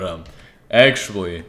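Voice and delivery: ordinary talk, nothing marked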